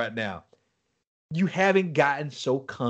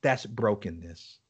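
low, soft and slow; voicing God; 8 semitones lower, 6 dB quieter, slower than usual. The Holy Spirit speaking to him, soft and low. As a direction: say it gently, lower. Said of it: "He says".

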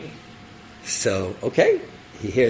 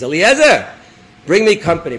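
loud, raised summons; calling out; 6 semitones higher, 10 dB louder than usual. Acted. Avram summoning his trusty servant, calling out. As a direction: call the servant over.

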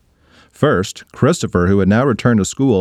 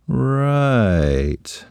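wary "Right". Acted. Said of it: "slowly backing up".

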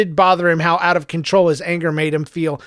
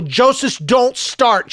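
sharp, higher warning to her son; loud and angry; 7 semitones higher, much slower than usual. Acted. Mother warning her son in the car, angry. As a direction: cut him off hard. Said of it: "Her voice was loud and angry".

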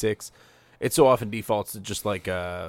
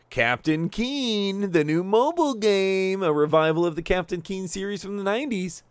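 slow, higher-pitched mock hype; sarcastic; 7 semitones higher, slower than usual. Host mocks mobile game announcement to co-host, sarcastic hype. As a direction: stretch it out with fake announcer excitement. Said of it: "Could you tell that was sarcastic?".

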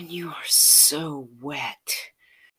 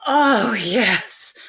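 higher, louder ecstatic moan of yes; pleasured cry; 7 semitones higher, 12 dB louder than usual. Acted. Lover crying out yes in pleasure, ecstatic moan.

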